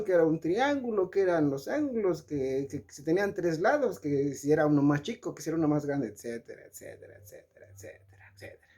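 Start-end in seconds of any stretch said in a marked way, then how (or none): 6.1-8.7 trailing off into a whisper; etcéteras fading to a whisper. Acted. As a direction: let the list die away to a breath, much softer.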